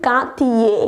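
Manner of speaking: Cartier as 'kar-tee-ay', silent r; correct pronunciation. Shown as a demonstration. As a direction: model Cartier for repeating, ending 'ay'. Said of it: "Repeat after me". (Cartierનો સાચો ઉચ્ચાર, 'કાર્તિયે')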